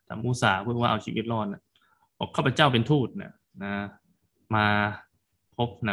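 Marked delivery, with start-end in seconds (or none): none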